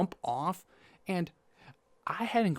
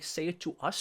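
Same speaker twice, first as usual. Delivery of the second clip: ordinary talk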